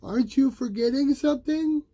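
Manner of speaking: high, loud reminding mother; mother's voice. A narrator's reading. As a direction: remind him pointedly. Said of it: "his mother called out".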